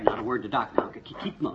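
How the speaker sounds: low, hurried undertone; hushed warning. Acted. Husband warning the others about Doc, hushed. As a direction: keep it from the newcomer's ears. Hiding find from arriving Doc.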